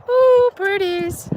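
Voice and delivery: high, drawn-out coo of delight; sing-song delight, pitched well up. Real, not acted. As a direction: coo slowly at something pretty. Cooing at pretty lights coming.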